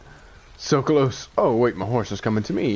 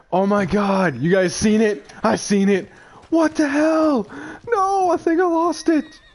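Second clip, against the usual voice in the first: high-pitched, distraught outcry; distraught disbelief; 12 semitones higher than usual. Real, not acted. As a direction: wail it as the prize slips away. Realizing the animal is lost.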